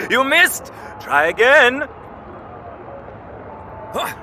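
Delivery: playful taunting. Acted. Balder teases brother in game.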